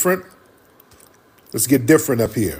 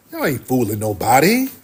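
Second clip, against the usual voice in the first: usual speaking voice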